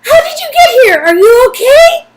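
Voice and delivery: tearful relief. Acted. Nadia hugging her cousin, tearful. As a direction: sob it out in relief.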